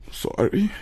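a tearful sorry that jumps high and breaks; real crying, the voice cracking up high; crying apology. Real, not acted. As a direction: apologize while actually crying. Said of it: "a whole lot of me crying".

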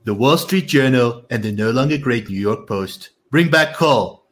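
emphatic, punched-up politician impression; Donald Trump. Reading a Trump statement aloud to a co-host, mock-Trump voice. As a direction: put on a showy Trump accent, punching key phrases. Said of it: "do it in my Trump accent".

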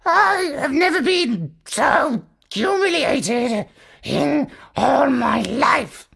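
high, slow, tearful parrot voice; high, slow humiliated parrot with a catch of tears; parrot rasping out. A narrator's reading. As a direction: squeeze out wounded pride in a high parrot voice. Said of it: "he managed to rasp".

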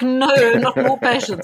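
weary protest. Retold or imitated. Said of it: "I was like".

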